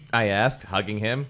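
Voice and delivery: ordinary talk, nothing marked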